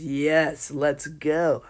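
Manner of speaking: loud, excited outburst in a retelling; eager battle cry. A narrator's reading. Eager challenger accepts the fight.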